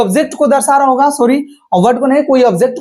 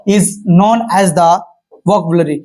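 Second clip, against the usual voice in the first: slow dictation; 5 semitones lower, much slower than usual. Shown as a demonstration. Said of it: "teacher dictates definition".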